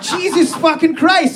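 mock horror at a gross find; shocked exclamation. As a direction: exclaim it, half laughing. Woman's shock on finding it.

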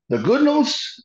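high, loud mock announcement; mock booming. Imitating Dick Murdoch announcing good news. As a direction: boom it out like a showman.

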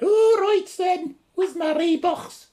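very high, loud, drawn-out Scouse; mock Scouse accent. Imitating Scousers who came to Newquay, high mock Scouse accent. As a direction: squeal it slowly in exaggerated Scouse. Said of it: "I learned to do Scouser one".

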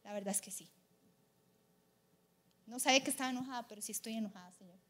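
soft, low admission trailing away; subdued, reluctant owning up; quiet confession. Retold or imitated. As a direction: admit it quietly to God. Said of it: "y yo le dije".

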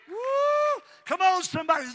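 high whoop into a loud shout; whoop, then holler to rouse them; ecstatic preaching shout. Real, not acted. Preacher calling on the congregation, whooping and shouting.

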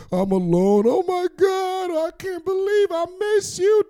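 mock sad. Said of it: "I told her I was all sad".

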